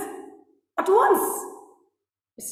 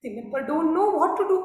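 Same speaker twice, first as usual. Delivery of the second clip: ordinary delivery, nothing marked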